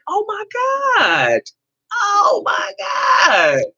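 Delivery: high, drawn-out anguished cry of shock; shocked oh my God. Retold or imitated. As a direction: cry out in disbelief at sad news. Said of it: "I was like".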